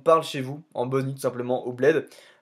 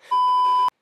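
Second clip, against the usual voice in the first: burst of laughter; 5 dB louder than usual. Real, not acted. Laughs at his own fumble.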